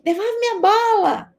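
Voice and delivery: grito infantil imitado, bem mais agudo e mais alto; criança gritando. Retold or imitated. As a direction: exija a bola de volta como uma criança brava. Criança imitada exige a bola. (criança imitada gritando pela bola, aguda e alta)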